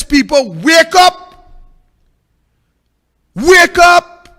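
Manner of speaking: loud, high shout of wake up; calling out. Real, not acted. Speaker calls on the people to wake up, shouting. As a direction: jolt the crowd awake.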